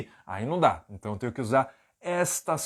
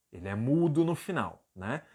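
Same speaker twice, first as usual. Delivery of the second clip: normal talking voice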